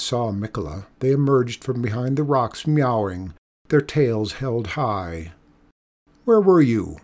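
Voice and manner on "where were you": mildly reproachful, louder and higher opening. A narrator's reading. Hungry cats to the woman who feeds them, reproachful. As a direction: scold them lightly for being late. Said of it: "seemed to say reproachfully".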